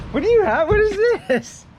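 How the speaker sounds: high excited questions. Real, not acted. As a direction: sound thrilled and curious. Excited at new gadget.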